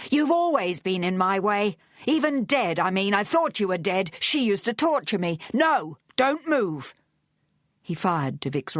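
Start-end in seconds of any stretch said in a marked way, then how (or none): 0.1-6.9 high-pitched, agitated rant; furious. A narrator's reading. Charles holding Vic at gunpoint, furious. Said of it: "on a furious rush of breath".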